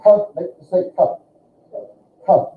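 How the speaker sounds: "k" from the back of the mouth; teacher drill of "k"; consonant demo. Shown as a demonstration. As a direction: click out "k". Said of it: "Because the K sound".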